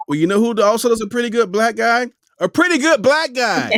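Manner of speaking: high, loud sarcastic jab, fast; mocking shout. Mocking the casting director in a high, loud sneer. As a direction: throw the line back in a loud, high sneer.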